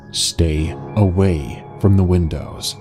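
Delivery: usual speaking voice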